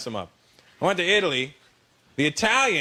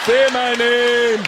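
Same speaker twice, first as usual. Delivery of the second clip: loud, high, drawn-out demand; mounting passion; 5 semitones higher, 6 dB louder, much slower than usual. Acted. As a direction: stretch it out loud in mock ecstasy. Lover's passion building.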